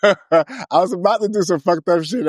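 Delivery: high, laughing confession. Real, not acted. Co-host owning up to mischief, laughing. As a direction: break into a laugh and talk through it.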